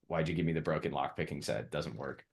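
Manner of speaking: put-on quiet, sulky kid complaining; sulky complaint to dad. Kid telling dad the lock picks are broken, sulky. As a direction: grumble it under your breath. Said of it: "you tell your dad".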